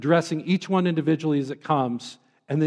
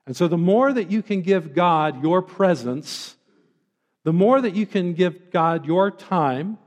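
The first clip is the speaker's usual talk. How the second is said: usual speaking voice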